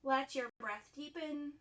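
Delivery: softened, measured cueing with long silences; guided yoga instruction. Acted. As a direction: guide them through breath and pose, leaving room between phrases. Leads breathing and yin yoga poses.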